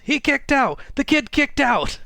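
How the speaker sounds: excited commentator's yell, pitched very high. Retold or imitated. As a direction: yell it in high-pitched, thrilled disbelief, imitating a ringside announcer. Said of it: "as J R excitedly yells".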